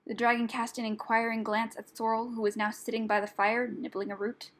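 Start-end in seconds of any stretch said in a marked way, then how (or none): none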